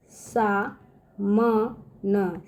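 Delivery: each syllable of सा म न said slowly and apart. Shown as a demonstration. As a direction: break the word into slow pieces. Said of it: "देखिए सामन".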